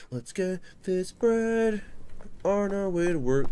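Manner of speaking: Let's get this bread crooned high and slow; high slow sing-song. Shown as a demonstration. Gamer croons his catchphrase high. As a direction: sing it up in a falsetto, drawn out. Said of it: "repeated catchphrase, varied".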